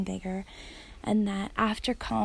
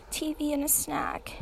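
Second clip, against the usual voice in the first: put-on high, whispered little-girl voice, softer; 6 semitones higher, 4 dB quieter than usual. Little girl telling daddy what she wants, childlike whisper. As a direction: mimic a small child asking for treats. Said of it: "And then the little girl goes".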